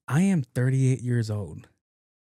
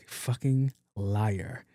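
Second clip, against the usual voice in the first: drawn-out, softer contempt; slow muttered verdict; 2 semitones lower, 5 dB quieter, much slower than usual. Acted. Doctor judging a lying patient, slow muttered verdict. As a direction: stretch out each word under your breath.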